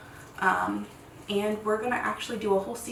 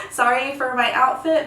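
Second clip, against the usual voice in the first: laughing; 3 semitones higher, 7 dB louder than usual. Real, not acted. Apologizing for her outfit, laughing.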